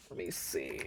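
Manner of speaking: low soft mutter. Real, not acted. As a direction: mutter to yourself.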